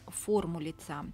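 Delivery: ordinary delivery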